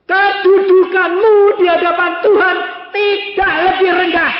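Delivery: high, loud, sustained proclamation; full-force preaching. Real, not acted. Preacher telling congregation their standing, impassioned shout. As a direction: thunder it out to the congregation.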